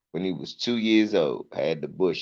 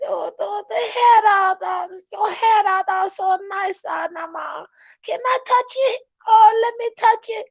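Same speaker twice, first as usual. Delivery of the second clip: much higher squealing lady voice; gushing lady. Retold or imitated. Comic mimicking a lady begging to touch a toddler's hair. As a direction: coo and beg to touch the head.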